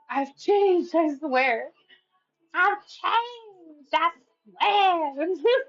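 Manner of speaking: put-on high, drawn-out drunken pleading; mock drunk. Drunk ex swearing they have changed, exaggerated whine. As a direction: ham up a tipsy ex's promise. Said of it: "might say this shit while they're drunk".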